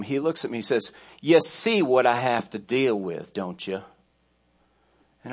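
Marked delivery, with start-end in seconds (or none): 1.2-3.8 put-on Southern accent. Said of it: "put the southern accent on it".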